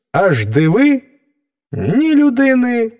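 usual speaking voice

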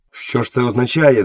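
usual speaking voice

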